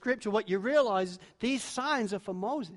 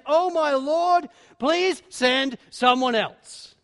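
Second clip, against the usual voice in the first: pleading; 5 semitones higher, 8 dB louder, much slower than usual. Acted. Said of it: "gets straight to the point".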